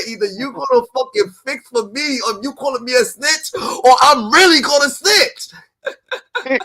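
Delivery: shouted, cracking threat, slowing and laughing at the end; imitating furious threat. Mocking Wack's snitch threat, shouting through laughter. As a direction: rant it till you break into laughter. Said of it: "He just said".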